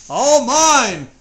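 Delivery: high, loud cry of triumph; gleeful cry. Acted. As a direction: shout it out as the proud king. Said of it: "Yertle cried".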